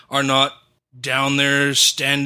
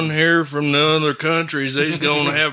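put-on Southern accent. Southern talk role play.

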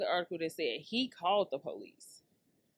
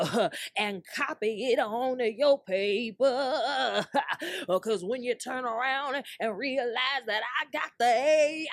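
rhythmic hammed-up sermon, higher, laughing; mock preaching; 6 semitones higher, 4 dB louder than usual. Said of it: "y'all feel the rhythm coming".

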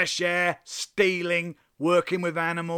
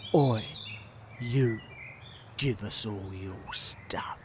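soft, low, slow whispered threat; slow menacing whisper; 7 semitones lower, 7 dB quieter, slower than usual. Acted. Highwayman repeats demand slowly, in a menacing whisper. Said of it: "say it nice and slow".

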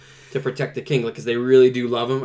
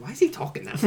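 puzzled wife; 9 semitones higher, 4 dB quieter, faster than usual. Retold or imitated. Voices wife's puzzled question.

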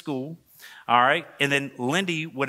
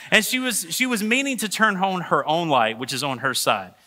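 ordinary talk, nothing marked

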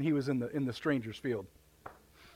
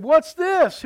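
put-on indignant older brother; 9 semitones higher, 8 dB louder than usual. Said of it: "He said".

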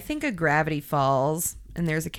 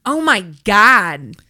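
shocked gasp; 3 semitones higher, 8 dB louder than usual. Real, not acted. Seeing the huge sluice.

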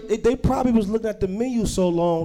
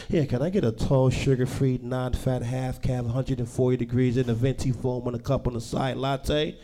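quieter, lower prim coffee order; 6 semitones lower, 5 dB quieter than usual. Acted. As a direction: rattle off a fussy latte order politely.

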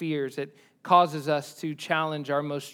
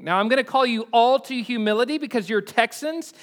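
ordinary talk, nothing marked

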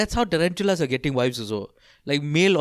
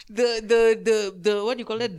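ordinary talk

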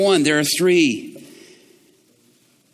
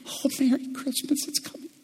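ordinary delivery

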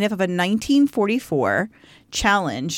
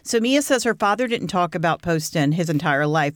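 ordinary talk, nothing marked

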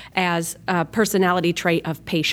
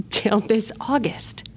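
breaking into tears, the voice catching; holding back sobs; starting to cry. Real, not acted. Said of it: "it's happening".

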